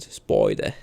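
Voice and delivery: "Spider" said the British way, no final r, like "spoi-duh"; a quick put-on British accent for the word "Spider"; mock British accent. Shown as a demonstration. Streamer reading a poem title, in a mock British accent. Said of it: "Let me give it my best British accent".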